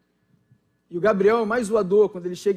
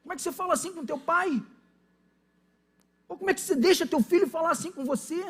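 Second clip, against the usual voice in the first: higher, fast outraged disbelief; incredulous scolding; 6 semitones higher, faster than usual. Retold or imitated. Pastor scolding a friend who lets his son talk back, fast and high in disbelief. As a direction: scold a friend as if he's gone mad. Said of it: "e falar, meu irmão".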